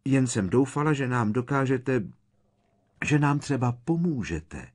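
high, loud anxious pleading; frightened protest. A narrator's reading. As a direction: protest urgently in alarm. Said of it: "vypískla vyděšeně Hermiona".

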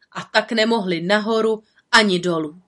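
usual speaking voice

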